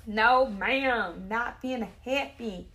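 loud, firm "No, ma'am"; loud disapproving stylist; loud refusal. Real, not acted. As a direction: shut it down out loud, no arguing. Refusing client haircut, loud.

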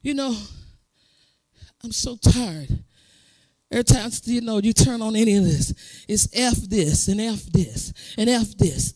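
tired, soft, low complaint; weary preacher. Retold or imitated. Retelling another preacher's tired complaint, soft and low. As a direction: sigh it out like a worn-out preacher. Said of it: "Preacher got up the other night and said".

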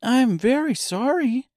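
put-on high girlish apology; apologetic. A narrator's reading. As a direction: say sorry sweetly. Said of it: "returned Dorothy".

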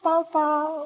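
a hint of a frightened child; imitated child's cry. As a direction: lightly imitate a scared child calling out. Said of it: "Dia teriak".

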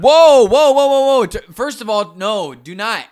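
high, loud whoa, whoa; alarmed shout. Real, not acted. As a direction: stop him in a panicked yell. Alarmed, stopping co-host.

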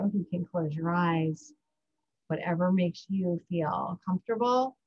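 gentle mindfulness cue, unhurried; guided breathing. Acted. Leads a breathing exercise.

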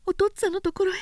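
put-on a girl's startled question; young woman hearing she'll be married; surprised young woman. A narrator's reading.

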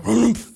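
loud, heavy grunted oomph; profound oomph. A narrator's reading. Skipper grunting with each hammock swing, loud oomph. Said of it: "a profound".

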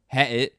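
"headed" with the middle d swallowed, loud; Cockney "headed". Shown as a demonstration. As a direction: say "headed" loudly in clipped Cockney. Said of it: "They drop the H".

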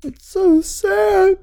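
high, slow, hammed-up sad voice; mock sad. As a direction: overplay the sadness, drawn out. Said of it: "You gotta act sad".

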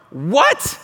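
sharp high shouted What; indignant one-word outburst; frustrated shout. Retold or imitated. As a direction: bark it out like an exasperated Paul. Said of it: "Exclamation point".

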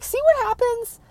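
very high mock told-you-so; hammed-up high voice, rubbing it in; exaggerated retelling. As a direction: pitch it way up as an overdone retort. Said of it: "I didn't say it like that".

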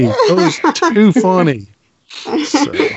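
said through a real laugh. Real, not acted. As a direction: laugh while you say it. Laughing at a joke.

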